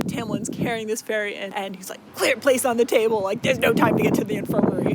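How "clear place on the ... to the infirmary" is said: loud, fast barked commands; urgent orders. Retold or imitated. Tamlin ordering everyone to clear the table, loud and fast. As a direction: shout it out like there's no time.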